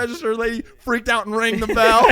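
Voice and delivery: loud laughing punchline; laughing so hard the words crack. Real, not acted. Finishing the Subway story to friends, laughing.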